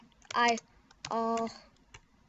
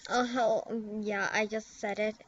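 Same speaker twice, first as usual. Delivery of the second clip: usual speaking voice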